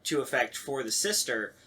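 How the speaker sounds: ordinary delivery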